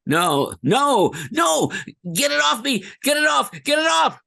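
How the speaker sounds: panicked high shouting; shaking off a spider. Acted. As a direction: scream as something grabs you. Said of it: "there it is, the famous line".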